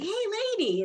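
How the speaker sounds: high, excited mock greeting. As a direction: imitate an excited man greeting his lady. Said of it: "he sounds excited".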